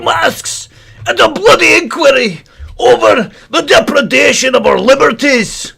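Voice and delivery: high, loud preacher rant; mock rant. Host ranting to his brethren about the bloody travesty, loud and high. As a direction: thunder it at the brethren.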